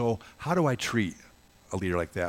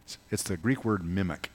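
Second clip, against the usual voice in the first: ordinary talk, nothing marked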